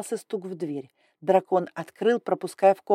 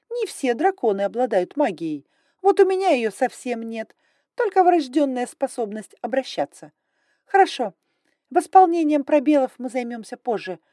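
higher, louder, brisk and imperious; haughty dragon lady; 6 semitones higher, 6 dB louder than usual. Acted. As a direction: talk down like a strict aristocrat. Grai voiced high and loud.

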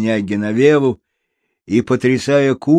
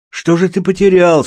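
calling question; 7 semitones higher, 5 dB louder than usual. Acted. Baker calls a question.

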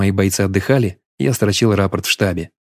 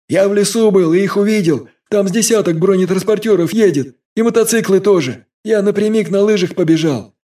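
put-on high, urgent boy's voice; breathless young messenger; 11 semitones higher than usual. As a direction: report it in a rush of alarm. Vaska reports Germans, voiced character.

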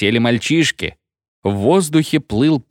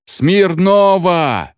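high, long-drawn call; crowd shout; 7 semitones higher, 3 dB louder, much slower than usual. A narrator's reading. Engine drivers demanding the singer Smirnov, shouting. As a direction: call the name out, stretching it. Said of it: "кричали машинисты".

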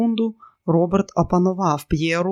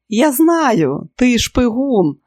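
high, loud, delighted guess; excited like a child; 7 semitones higher, 6 dB louder, much slower than usual. A narrator's reading. Pyera guessing her lover is a spy, excited like a child. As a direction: blurt it out like a thrilled child. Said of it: "Вона була збуджена, як дитина".

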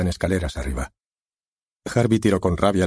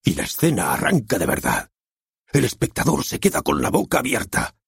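lightly voiced director; 2 semitones lower than usual. Director conjures the opening scene for the author, lightly voiced. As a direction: paint the scene, drawing him in.

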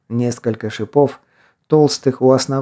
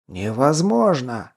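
ordinary delivery, nothing marked

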